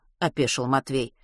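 plain reading voice

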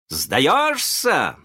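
high taunting question; gloating challenge. A narrator's reading. Boy challenges the pirates, gloating.

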